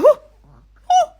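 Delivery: very high loud squealing whoa; shocked high-pitched squeal. Retold or imitated. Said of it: "I literally squealed".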